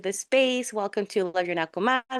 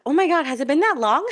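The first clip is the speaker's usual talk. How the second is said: high, quick, louder burst of surprise; surprised at yourself; amused disbelief; 7 semitones higher, 5 dB louder, faster than usual. Real, not acted. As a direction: exclaim in startled amusement, breaking into a laugh. Realises she forgot to introduce herself.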